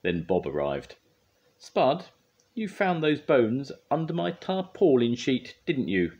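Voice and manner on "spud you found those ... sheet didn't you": high, loud telling-off; scolding with a pointed tag question; accusing. A narrator's reading. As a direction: confront the culprit, slow and pointed. Bob confronts Spud over bones.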